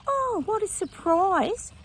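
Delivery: drawn-out sarcastic oh; slow mocking what a surprise. Real, not acted. Sarcastic reaction to news.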